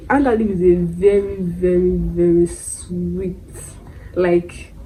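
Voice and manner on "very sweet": "sweet" drawn out slowly; stress on sweet. Real, not acted. Reviewer warning viewers about the perfume, stressing "sweet" slowly. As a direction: stretch out "sweet" for emphasis. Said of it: "Emphasis on sweet".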